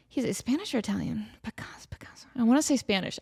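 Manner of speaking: whispered pondering; whisper to self. Real, not acted. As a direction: whisper the guess to yourself. Muttering to self.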